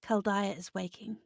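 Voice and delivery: softly voiced, hint of an ominous motherly presence; lightly played comforting otherworldly mother, hushed; dark maternal voice. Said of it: "dark, deep voice. Comforting. Almost m-, maternal".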